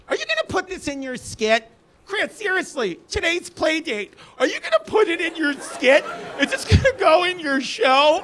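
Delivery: high-pitched mock suburban mom; mock nagging mom. Voicing the mother at playdate.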